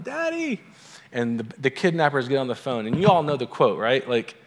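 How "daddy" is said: high-pitched mock cry for Dad; kidnapped daughter. Kidnapped daughter phoning her father, high mock cry. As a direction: imitate a daughter calling out, very high and thin in volume. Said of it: "she calls him from her cell phone".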